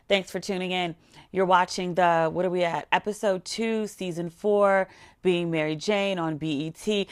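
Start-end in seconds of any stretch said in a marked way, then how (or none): none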